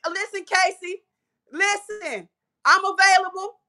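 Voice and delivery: building to a high, loud call; volunteer loudly, pitch climbing on the repeat; eager shout. Real, not acted. Speaker volunteering for the next summit, eager shout.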